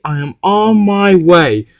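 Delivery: usual speaking voice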